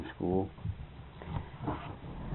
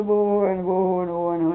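higher, repetitive mock sobbing; mock bawling; 5 semitones higher than usual. Daughter runs crying to her mother, imitated bawling. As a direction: imitate a girl boo-hooing on and on. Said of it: "boy, she bellered".

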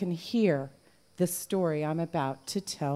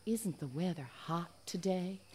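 whispered sweet nothing; 12 dB quieter than usual. A narrator's reading. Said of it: "whispering first to the one".

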